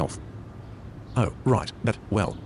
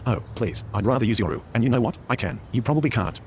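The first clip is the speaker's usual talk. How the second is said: ordinary talk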